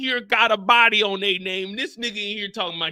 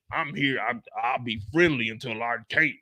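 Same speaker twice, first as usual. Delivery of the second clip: lower, lightly mocking cop voice; understated impression of a stiff officer; mocking Howard; 9 semitones lower, 3 dB quieter than usual. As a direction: drop the voice to mimic the cop.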